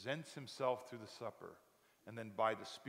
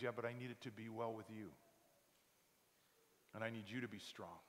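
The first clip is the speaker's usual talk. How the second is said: loving Jesus. Acted. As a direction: reassure them gently. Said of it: "speaks to you that lovingly".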